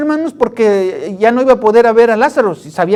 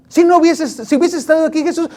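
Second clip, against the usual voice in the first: higher, faster, pleading reproach; Mary reproaching Jesus, pitched up and quick; reproachful complaint; 5 semitones higher than usual. Retold or imitated. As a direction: blame him for not coming, pitched up and hurried. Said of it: "Le reclamó".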